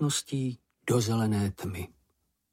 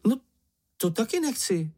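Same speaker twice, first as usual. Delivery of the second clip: high-pitched protest, only mildly exclaimed; mild cry; 11 semitones higher than usual. A narrator's reading. Čenich does not want to go first, mild high cry. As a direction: object in a small high voice. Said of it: "vykřikl Čenich".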